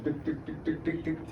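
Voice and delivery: high, soft clipped "tick, tick, tick"; imitated tapping. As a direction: tap the "tick" sounds out lightly like tapping a cork. Tapping the cork in.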